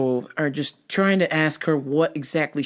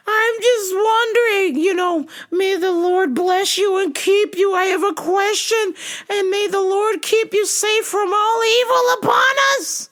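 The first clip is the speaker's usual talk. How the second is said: put-on preachy old lady; 4 dB louder than usual.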